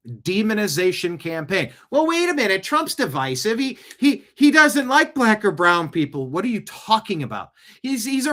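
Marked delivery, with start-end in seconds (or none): 1.9-6.3 high, sneering mock voice of a critic; lightly voiced whiny opponent; mocking quote. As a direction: parrot the critics with scorn.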